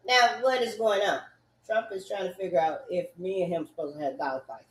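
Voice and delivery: ordinary delivery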